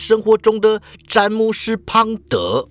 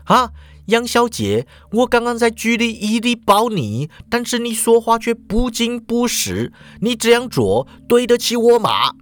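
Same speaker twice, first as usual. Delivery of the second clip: higher, pressing reproach; somewhat angry; 7 semitones higher than usual. Acted. Jack scolds Shuhua for hiding the truth, mildly angry. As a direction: confront her for lying. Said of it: "有点生气的又说了".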